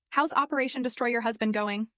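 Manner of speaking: plain reading voice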